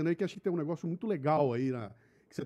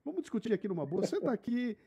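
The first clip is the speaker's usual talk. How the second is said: lightly voiced calm peacemaker, higher pitched; gently mock-pleading to cool things down, pitched up; raised pitch, restrained volume, playful imitation of a conciliator; imitating Bruno; 6 semitones higher, 3 dB quieter than usual. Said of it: "O Bruno é o cara que".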